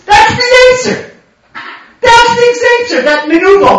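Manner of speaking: high, loud cry of outrage; ringing, high-pitched denunciation; indignant exclamation. Real, not acted. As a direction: shout it high as a damning verdict. Exclaims at the Yetzer's low betrayal.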